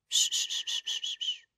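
soft whispered shh to calm her; soothing shushing. A narrator's reading. Edward calming the injured girl, soft soothing shush. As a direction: hush her gently, barely voiced. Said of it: "machte er beruhigend".

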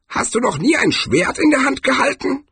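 put-on very high old man's question; old barbarian. Old barbarian questioning a clumsy swordsman, high voice. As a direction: ask incredibly high, as a greybeard.

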